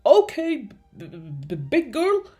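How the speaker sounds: loud start, then stuttering; stammering, jumpy voice; nervous stammer. A narrator's reading. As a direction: trip over the B in fright. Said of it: "he stammered".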